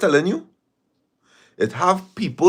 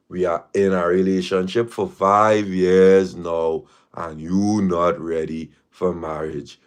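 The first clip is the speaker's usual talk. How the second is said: slower; 6 semitones lower, slower than usual. Shown as a demonstration. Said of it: "let me say it slower".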